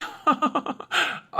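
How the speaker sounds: quiet airy chuckle, unvoiced; husband chuckles at his staged win; soft breathy laugh. Real, not acted.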